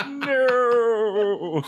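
long, high, hammed-up scream; shriek. A narrator's reading. Chris losing control at her sister, hammed-up shriek. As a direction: draw out a melodramatic shriek. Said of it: "Chris shrieked".

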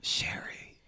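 breathy unvoiced whisper of a name. Mother whispering the caller's name in shock, voiceless. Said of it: "my mother whispered".

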